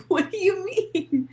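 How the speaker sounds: high, loud and slow, stretched out; mock confused quote. Streamer re-enacts her confusion, slow high mock disbelief. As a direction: drag it out in disbelief. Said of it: "I was like".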